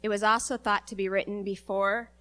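ordinary delivery

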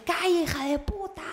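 high mock-angry snarl from the other driver; a high hammed-up furious driver; imitating an insult. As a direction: play the rude driver spitting the insult. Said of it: "me dice".

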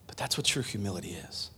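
ordinary talk

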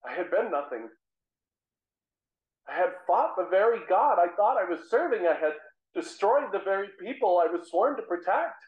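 normal talking voice

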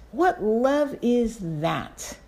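"What love is that?" drawn out very slowly, falling; slowed, awed repeat; lingering awe. Real, not acted. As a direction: let the question hang in wonder. Slower second take of question.

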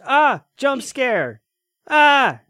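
sudden loud high yelp; mock scream. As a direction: shriek like a cheap jump scare. Mocking a cheap jump scare.

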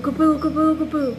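high, loud mock alarm; husband rushing in. Wife imitates her husband running to the kitchen, high and urgent. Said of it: "Bruce viene así rápido".

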